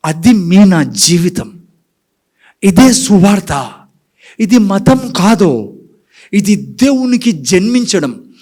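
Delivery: slow, weighty preaching lines; slow proclamation. Acted. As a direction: lay down each phrase slowly. (నెమ్మదిగా, గంభీరంగా ప్రకటించడం)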